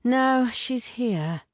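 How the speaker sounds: slow, sighing reply; sighed. A narrator's reading. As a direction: let the words drag out on a sigh. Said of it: "Enzo sighed".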